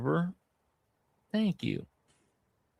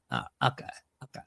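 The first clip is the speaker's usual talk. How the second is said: Vince Vaughn impression; 2 semitones higher, 7 dB quieter, much faster than usual. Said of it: "That's my Vince Vaughn".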